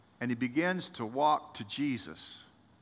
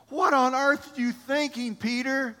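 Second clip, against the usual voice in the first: incredulous; 11 semitones higher, 5 dB louder than usual. Acted. Voicing disbelieving disciples.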